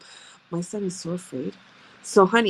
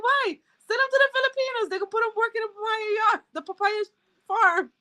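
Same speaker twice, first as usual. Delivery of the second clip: mock outrage; 12 semitones higher than usual. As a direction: shout it like an indignant joke. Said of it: "I'm like".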